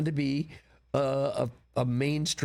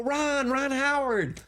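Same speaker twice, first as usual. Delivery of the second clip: high, drawn-out calling voice; retold yell; 12 semitones higher, slower than usual. Producer retells yelling to Ron Howard from his window, calling out. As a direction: imitate calling out to someone far below. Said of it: "I yell out the window".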